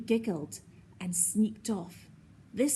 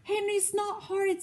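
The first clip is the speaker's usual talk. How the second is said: Peter defending; 12 semitones higher than usual. A narrator's reading. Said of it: "said Peter".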